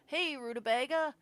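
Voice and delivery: high, smiling hello; cheerful greeting. A narrator's reading. Dad greeting child in doorway, cheerful high voice. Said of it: "expression quickly changes to a smile".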